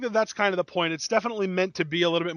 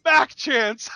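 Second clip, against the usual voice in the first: laughing; 9 semitones higher, 5 dB louder, slower than usual. Real, not acted. Crowd chant, laughing.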